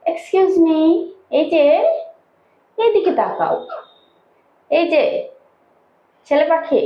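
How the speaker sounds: high-pitched pet-talk; calling a pet. Real, not acted. Calling bird to look over.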